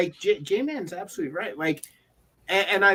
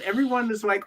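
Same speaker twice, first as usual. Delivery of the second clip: ordinary delivery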